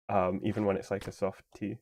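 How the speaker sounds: ordinary talk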